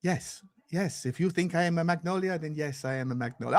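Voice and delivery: put-on low, soft Swedish-accented deadpan; subdued, badly faked Swedish accent; Johan Lang. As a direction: concede it quietly in stiff Scandinavian English. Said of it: "I can't do the Swedish accent".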